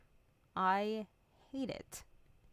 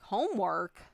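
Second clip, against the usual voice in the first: incredulous homework; 7 dB louder than usual. Retold or imitated.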